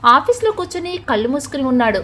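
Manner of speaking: ordinary delivery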